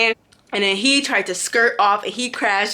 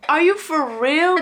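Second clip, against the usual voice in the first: outraged disbelief; 7 semitones higher, slower than usual. Real, not acted. Outraged at the supervisor.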